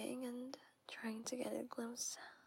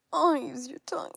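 much louder moaning demand; loud moaned plea; 2 semitones higher, 16 dB louder, faster than usual. Acted. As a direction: cry it out with pleasure.

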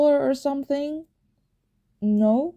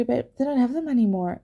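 ordinary talk

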